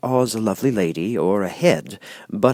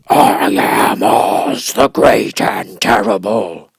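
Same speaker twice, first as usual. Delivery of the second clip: slow, loud monstrous roar; louder, very slow beast voice; great roar; 8 dB louder, much slower than usual. Acted. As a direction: boom it out like a terrible beast. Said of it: "in a voice that was one great roar".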